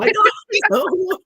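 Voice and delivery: very high, loud shriek; mock shrill mother. Hosts quoting the movie mother, high loud shriek. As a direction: screech it like the film's mother. Repeats the quote again.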